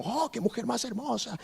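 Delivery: admiring aside. Retold or imitated. Imagined admirers' praise.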